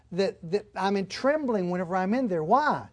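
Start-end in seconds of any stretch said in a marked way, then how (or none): none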